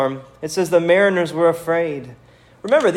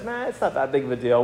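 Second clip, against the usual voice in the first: offhand dismissal; 2 semitones lower, 6 dB quieter, faster than usual. Retold or imitated. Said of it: "our culture says".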